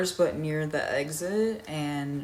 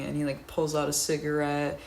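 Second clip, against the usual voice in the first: normal talking voice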